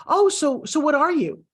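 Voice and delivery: high, mock-curious prying; pry like a clueless stranger; nosy questioner.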